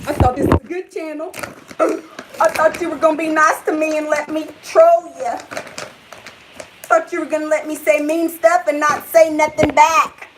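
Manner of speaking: mock the troll to his face; taunting the troll. Real, not acted.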